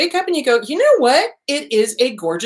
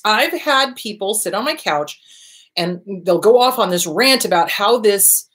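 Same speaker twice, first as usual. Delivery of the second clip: ordinary talk, nothing marked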